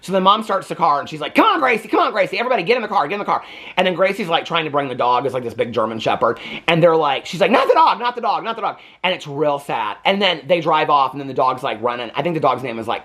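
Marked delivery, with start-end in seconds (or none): 1.4-3.4 high, urgent calling; hurrying mom. Retold or imitated. Mom hurrying Gracie and the kids into the car, high and urgent. 7.5-8.8 high, anguished pleading; distressed girl. Retold or imitated. Gracie begging not to leave the dog, high and anguished.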